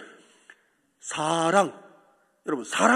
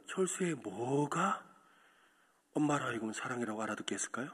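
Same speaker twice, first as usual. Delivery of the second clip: softer, lower tender sermon voice; hushed preaching; 8 semitones lower, 9 dB quieter than usual. Acted. Preacher softly tells the church tears are love. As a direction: bring them close, gently.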